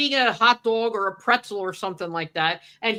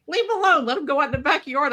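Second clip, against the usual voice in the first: higher, breezy mock husband voice; husband waving off his wife's objection, higher and breezy; imitating Paul; 6 semitones higher, faster than usual. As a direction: lightly play the easygoing husband brushing it off. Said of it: "Paul, of course, is like".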